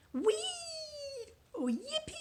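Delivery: high playful yippee cry; playful whee. Pretend. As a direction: shout it like going down a slide. Acting sliding down a slide.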